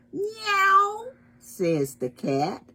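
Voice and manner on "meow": very high, drawn-out meow; cat's meow. Acted. Cat by the window in a story, high meow.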